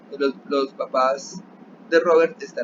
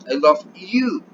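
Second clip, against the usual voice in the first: "you" hit high and loud, then falling; emphatic "you" ending the phrase; stress on YOU; 3 semitones higher, 6 dB louder, slower than usual. Shown as a demonstration. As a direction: save the punch for the final "you". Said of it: "Or if you say".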